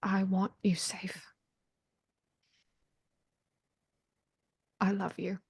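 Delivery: soft whispered goodbye; tender whisper. Acted. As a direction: whisper it gently to a lover. Tender farewell message.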